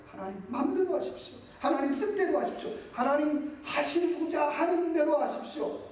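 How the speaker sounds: impassioned surrender prayer; fervent surrender. Shown as a demonstration. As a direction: give yourself over fully. Demo of surrender praise.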